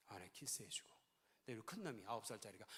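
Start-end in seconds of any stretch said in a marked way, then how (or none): 0.1-0.9 whisper. Real, not acted. Quiet aside.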